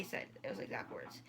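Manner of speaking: breathy whispered aside, no voice; hushed confiding whisper. Real, not acted. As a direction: whisper it like a secret to the viewers. Aside swearing the quote is true.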